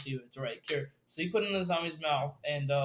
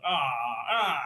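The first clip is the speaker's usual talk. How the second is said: nasal-style villager groans in imitation; Minecraft villagers; 4 dB louder than usual. Describing the creatures following the group, imitated villager groans. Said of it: "it sounds like kind of the villagers from, like, Minecraft".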